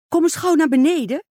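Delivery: high, loud and eager; bursting with news, call her down; excited. Acted. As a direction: stepmother excitedly calling the girl downstairs. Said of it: "herhaalde ze opgewonden".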